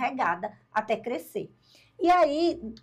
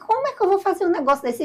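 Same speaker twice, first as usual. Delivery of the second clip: flustered protest; 7 semitones higher, 5 dB louder, much faster than usual. Retold or imitated. Protesting the command, rushed.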